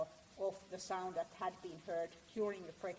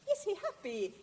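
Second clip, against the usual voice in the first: high, loud and cheerful; happy voice; 10 semitones higher, 7 dB louder than usual. Shown as a demonstration. Lecturer demonstrating a happy voice to the audience, bright and high. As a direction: bounce it up like a happy person. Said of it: "how the person's voice sounds like".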